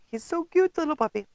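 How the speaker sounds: cooing. Real, not acted. Cooing at cute stamp design.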